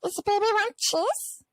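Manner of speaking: put-on very high baby voice; baby talk to a cat. Talking to her cheese-loving cat, high baby voice. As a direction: coo it like talking to a pet. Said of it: "in this really high-pitched voice".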